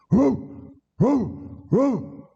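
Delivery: short high dog yelps; imitated dogs baying. Dogs chasing escaped convicts, imitated short high barks. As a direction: yip like tracking dogs in pursuit. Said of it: "they hear the dogs".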